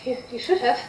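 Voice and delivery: barely above a murmur, timid; very soft voice. Acted. Tiny sprite answers the apologizing player, very soft. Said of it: "his voice is very, very soft".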